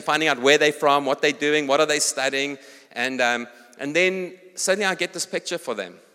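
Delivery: usual speaking voice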